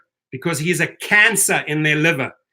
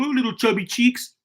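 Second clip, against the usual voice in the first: higher, sneering mock of a bully; mocking taunt; 7 semitones higher than usual. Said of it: "mocking Kevin and saying".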